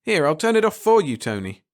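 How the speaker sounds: plain reading voice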